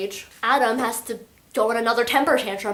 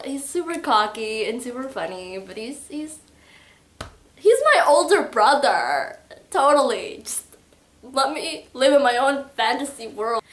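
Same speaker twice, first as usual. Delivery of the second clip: usual speaking voice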